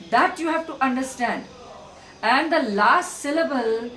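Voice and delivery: usual speaking voice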